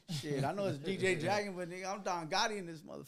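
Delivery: usual speaking voice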